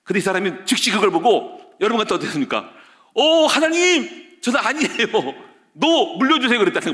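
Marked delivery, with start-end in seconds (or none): 3.1-6.6 pleading prayer. Retold or imitated. Preacher voices pleading prayer.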